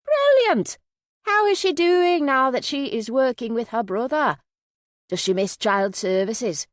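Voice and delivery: put-on high, effusive Yorkshire accent; Minnie. A narrator's reading. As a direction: burst out high, then chat on in Yorkshire. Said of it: "Minnie's Yorkshire accent".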